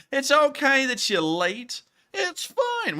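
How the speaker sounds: very high, drawn-out mock reassurance; playful high-pitched reassurance. Streamer letting a late viewer off, in playful high-pitched reassurance.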